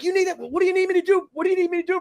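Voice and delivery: high, pressing repeated question; urgent offer. Retold or imitated. Bystander running up to a struggling cop, urgent. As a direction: re-enact an urgent offer of help.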